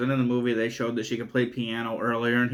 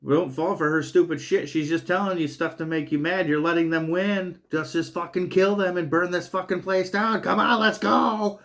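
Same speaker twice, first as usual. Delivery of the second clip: mild mock yell, rising higher toward the end; retold yelling; 5 semitones higher than usual. Amy urging the dad to kill them, mild mock yell. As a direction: urge him on, half-yelled. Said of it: "Amy yelling at him".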